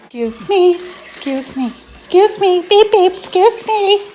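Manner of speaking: high, loud playful "excuse me", like a car honking; silly squeaky voice. Pretend. Playful squeaky shout at someone.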